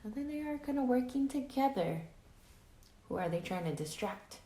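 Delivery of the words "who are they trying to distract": plain reading voice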